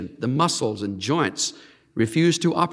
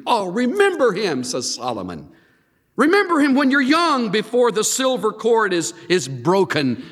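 pleading preacher; 7 semitones higher than usual. Acted. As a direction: plead it from the pulpit. Preacher quoting Solomon's plea.

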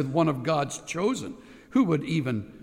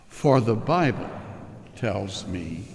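put-on slow, soft, low recital of the children's song; gentle, hushed hymn words; tender hymn recital; 11 semitones lower, 5 dB quieter, slower than usual. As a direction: hush and savour each line.

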